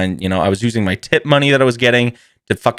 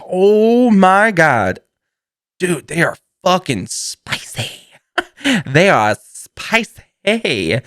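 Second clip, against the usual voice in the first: exaggerated emphasis; 8 semitones higher, much slower than usual. Real, not acted. Emphasising the hot sauce.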